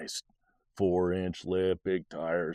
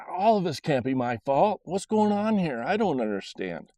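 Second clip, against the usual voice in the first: frustrated outburst; 6 semitones higher than usual. Retold or imitated. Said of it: "mad, angry, frustrated".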